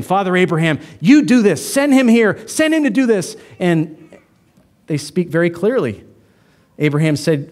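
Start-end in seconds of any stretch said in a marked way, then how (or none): 0.0-3.4 louder, higher commanding; demanding rich man. Retold or imitated. Rich man in hell ordering Abraham loudly. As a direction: order Lazarus sent, loud and bossy. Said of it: "He's looking and saying".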